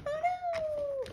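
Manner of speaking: surprised. Real, not acted. Marker rolls off the table.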